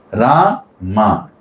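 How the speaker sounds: "Rama" broken into "Raa" and "ma"; syllable split. Shown as a demonstration. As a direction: say "Rama" as two separate, held syllables. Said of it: "two syllables".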